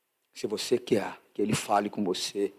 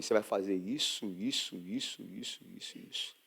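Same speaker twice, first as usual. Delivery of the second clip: low voice sinking from soft to a breath; hushed, dwindling voice of the Spirit; fading divine voice; 4 semitones lower, 9 dB quieter than usual. Retold or imitated. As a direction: let the repeated word fade almost to nothing. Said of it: "O Espírito Santo veio e falou pra mim".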